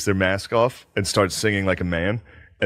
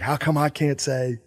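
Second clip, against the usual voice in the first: lightly voiced slow heckler; retold heckler; 3 semitones higher, 5 dB quieter than usual. Imitating an audience member questioning the comedian, slow put-on complaint. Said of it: "the guy was like".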